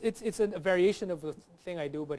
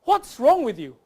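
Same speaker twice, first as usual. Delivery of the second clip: loud, high proper-English reproach; indignant grammatical English accent; perfect English accent; 7 semitones higher, 11 dB louder than usual. Shown as a demonstration. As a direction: demand it in a correct English accent. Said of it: "perfect grammatical English".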